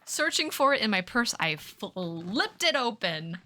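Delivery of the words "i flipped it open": slow. Acted. Trying line readings.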